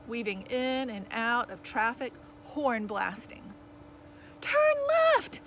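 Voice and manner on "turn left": high, loud shouted direction; bawling. A narrator's reading. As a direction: bawl it out to the driver. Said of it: "bawled Naomi".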